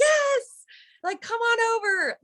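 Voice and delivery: very high, gushing yes; eager yes. Retold or imitated. New arrival answering the party guys, squealing high with eagerness. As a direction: leap at the invitation.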